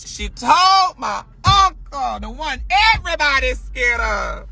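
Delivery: hammed-up aghast reveal; horrified disbelief. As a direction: drag each word out in dramatic outrage.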